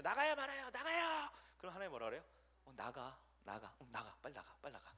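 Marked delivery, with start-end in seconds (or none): 0.0-1.3 high, loud, insistent begging; pleading demand. Pretend. Believer badgering God about the election, loud high pleading. 2.6-4.9 softer, lower, resigned shooing; God giving in. Pretend. God worn down by the nagging believer, softly waving them off.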